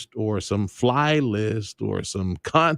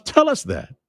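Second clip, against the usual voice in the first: higher, insistent demand. Real, not acted. Host urging officials to tell the public, insistent. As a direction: press them to just say it.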